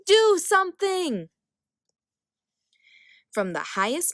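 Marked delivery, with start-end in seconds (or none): 0.0-1.3 high, loud bossy demand; yelling. A narrator's reading. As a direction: order him to act, yelling. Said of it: "yelled Hera".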